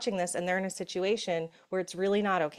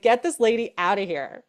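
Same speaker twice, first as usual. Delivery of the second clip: mock exasperated court; 2 semitones higher, 7 dB louder than usual. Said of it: "They're like".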